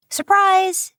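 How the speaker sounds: high, loud cheer of Surprise; spring it on her with a big joyful yell; group surprise shout. Acted. Surprise party, everyone shouts.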